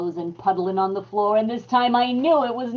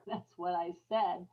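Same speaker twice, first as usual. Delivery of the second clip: sly laugh; 3 semitones lower, 12 dB quieter than usual. Acted. Chuckles over her lie.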